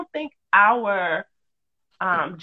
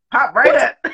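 loud; 3 semitones higher, 7 dB louder than usual. Retold or imitated. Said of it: "I was like".

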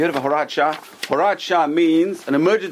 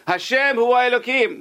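crowd's cry; 9 semitones higher than usual. Retold or imitated. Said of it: "They all scream".

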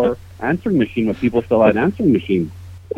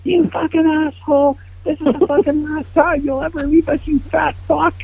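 high, loud furious scream down the phone; 4 dB louder than usual. Retold or imitated. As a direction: scream it at him with pure venom. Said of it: "I was just screaming into it".

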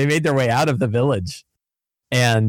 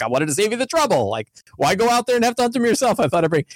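lightly voiced hunter, pitched up; higher-pitched, lightly acted excuse; a hint of a defensive underling; hunter making excuses; 10 semitones higher than usual. Acting the hunter's excuse to leader.

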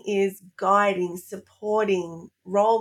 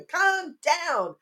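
put-on high-pitched little-kid answer; putting on a high child's voice; imitated little child; 9 semitones higher than usual. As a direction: play a small child reciting the answer. Voices her little son answering her.

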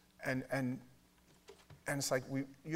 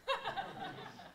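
laugh; 7 dB quieter than usual. Retold or imitated. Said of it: "he starts laughing".

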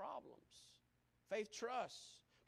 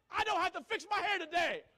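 high, loud gleeful shout; joyful shout; 9 semitones higher, 10 dB louder than usual. Retold or imitated. Bald lady shouting her joy at the mirror. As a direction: shout it out with relief. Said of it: "And she shouted".

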